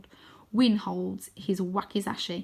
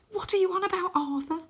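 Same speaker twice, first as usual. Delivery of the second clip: put-on incredulous boy; 11 semitones higher than usual. Arthur's friend voiced high.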